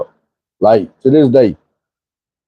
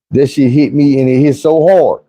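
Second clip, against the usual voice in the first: usual speaking voice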